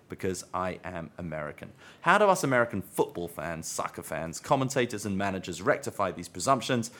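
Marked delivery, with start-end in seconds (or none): none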